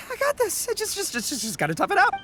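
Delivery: panting and straining to talk; words broken by heavy breathing. Acted. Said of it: "I gotta catch my breath here".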